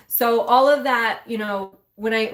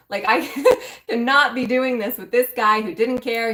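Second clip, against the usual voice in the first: laughing through it; 3 dB louder than usual. Real, not acted. Laughing at her own past reaction.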